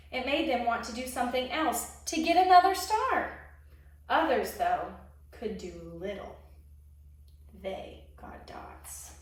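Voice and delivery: lively storybook narration; expressive picture-book reading; reading to children. A narrator's reading. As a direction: read it like a children's story time. Reads a picture book aloud.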